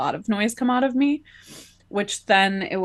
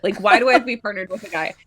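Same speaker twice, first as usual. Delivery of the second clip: fast, laughing, louder disbelief; laughing panic; 4 semitones higher, 7 dB louder, much faster than usual. Retold or imitated. Panicking inside about her partner, fast with laughter. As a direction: blurt it through a laugh. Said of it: "inside I was going".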